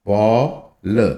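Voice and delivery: पॉल drawn out very slowly on the all sound; stretched demo. Shown as a demonstration. Teacher slowly showing the all sound in पॉल. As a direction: hold the ऑ in पॉल. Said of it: "तो all वाली sound".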